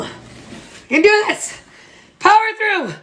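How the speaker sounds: high, forceful push through tired arms; self pep talk. Pretend. Baker urging sore arms on, high mock-heroic pep talk.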